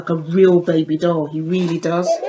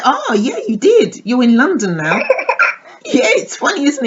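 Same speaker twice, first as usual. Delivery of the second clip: higher, sing-song cooing at a new doll; 6 semitones higher than usual. Real, not acted. Greeting new baby doll excitedly.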